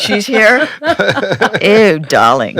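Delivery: mock-posh British, deliberately bad; shitty British accent. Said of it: "with my shitty British accent".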